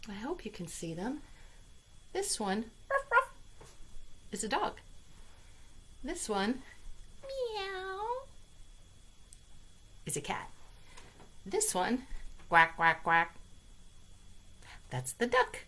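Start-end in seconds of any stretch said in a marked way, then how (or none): 2.9-3.3 high, loud yappy barking; playful dog woof. Pretend. Animal sounds for kids. 7.2-8.0 high cat mew; playful cat voice; cat meow. Pretend. Animal sounds for kids. 12.5-13.4 loud quacking; playful duck call. Pretend. Animal sounds for kids.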